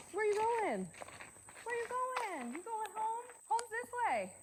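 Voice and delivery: high-pitched dog talk; coaxing the dog in a high voice. Real, not acted. Calling dog that runs away.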